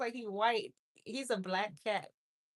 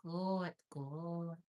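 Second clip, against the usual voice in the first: ordinary talk, nothing marked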